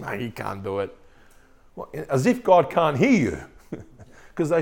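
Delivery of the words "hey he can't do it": low, soft grumbling mutter sinking to a whisper; doubting grumbler. Retold or imitated. Israelites doubting God in the wilderness, low grumbling mutter. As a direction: mutter the doubt under your breath, then whisper it. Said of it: "it might sound something like this".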